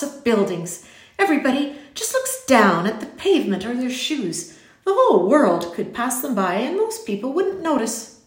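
loud, high-pitched emphatic complaint; exasperated eccentric gentleman; irritable. A narrator's reading. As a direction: rant peevishly, punching key words. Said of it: "voice was suddenly irritable".

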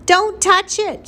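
very high, slow, loud warning; screamed. A narrator's reading. Mother stops Flora reaching for the squirrel, screaming. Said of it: "screamed her mother".

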